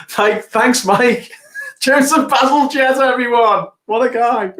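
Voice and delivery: excited. Real, not acted. Delighted thanks after fun fact.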